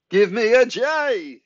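high, slow, drawn-out cheerleader call; cheerleader yell. A narrator's reading. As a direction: belt it out like a school cheerleader. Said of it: "he yells".

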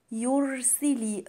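"يرسل" slowly chanted with a heavy, full r; heavy raa. Shown as a demonstration. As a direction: recite "يرسل" slowly, thickening the ر. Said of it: "الراء هنا مفخمة". ("يرسل" براء مفخمة وبتأنٍ)

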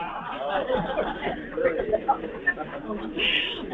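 high, airy laughter. Real, not acted. Speaker laughing along with the congregation. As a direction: burst into breathy laughter.

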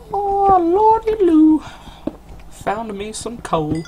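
put-on very high, slow, stretched-out old-timer whoop; hammed-up yokel. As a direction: crow over the coal like a cartoon prospector. Hammed-up joy at finding coal.

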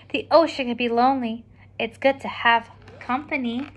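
ordinary delivery, nothing marked

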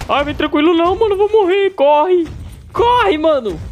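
panicked high yelling; being chased. Real, not acted. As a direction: scream as the monster closes in. Monster chasing him in game.

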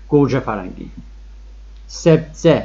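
usual speaking voice

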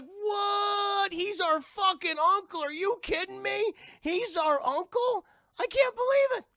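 very high, loud squeals of disbelief; excited boys. Retold or imitated. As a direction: shriek like kids hearing amazing news. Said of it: "they're all excited".

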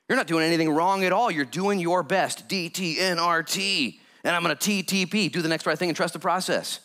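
fervent sermon delivery; preaching. Acted. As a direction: preach it to the congregation, full of conviction. Pastor preaching to congregation.

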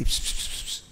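soft, voiceless secretary's whisper; mimicked hushed murmur; imitated whispering. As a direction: whisper it into his ear. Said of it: "Του λέει κάτι".

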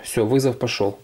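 usual speaking voice

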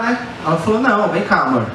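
usual speaking voice

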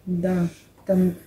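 usual speaking voice

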